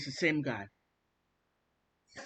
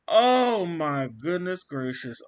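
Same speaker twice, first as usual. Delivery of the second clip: laughing; 7 dB louder, slower than usual. Real, not acted. Still laughing at own word.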